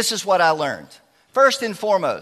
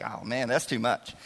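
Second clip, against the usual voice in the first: put-on reluctant teen; 6 semitones lower, 9 dB quieter than usual. Mimics reluctant teen girl.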